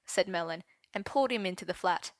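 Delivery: ordinary delivery, nothing marked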